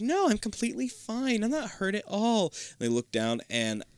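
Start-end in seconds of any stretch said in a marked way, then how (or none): 0.0-2.5 put-on girl voice. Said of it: "she's like".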